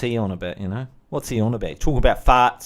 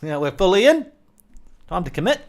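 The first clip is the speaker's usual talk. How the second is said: higher, rising mock-dad line; joking quote of Dad; 7 semitones higher than usual. What Dad said to Mum, raised joking voice. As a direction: lift it into a cheeky rising quote.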